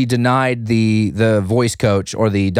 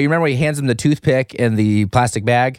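ordinary talk, nothing marked

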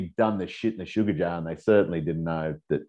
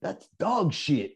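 angry neighbour; 14 semitones higher, slower than usual. Retold or imitated. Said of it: "one guy's going".

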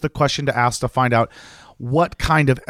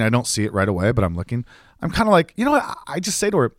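ordinary delivery, nothing marked

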